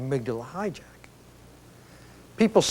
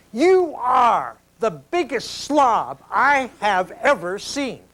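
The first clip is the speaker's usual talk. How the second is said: mock angry wife, slow and higher; hammed-up slow jab at her husband, pitched up; steamed-up accusation; 6 semitones higher, slower than usual. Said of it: "She's really burning".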